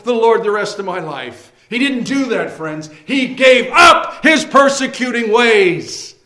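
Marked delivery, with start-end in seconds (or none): 0.0-1.5 lightly voiced careless convert; brisk, higher mock-casual excuse; careless believer voice. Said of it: "He didn't have this false idea". 3.1-6.1 slow, loud proclaiming; emphatic shout. Acted. Preacher proclaims to congregation.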